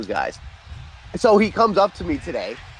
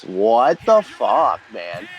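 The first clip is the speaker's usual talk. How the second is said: stunned disbelief; 7 semitones lower, much slower than usual. Real, not acted. Shock at bad result.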